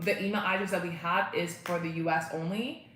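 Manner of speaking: normal talking voice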